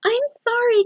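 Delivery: very high, small creature voice; Snatch-a-Book apologising to Eliza in a tiny high voice; little tiny voice. A narrator's reading. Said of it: "came a little tiny voice".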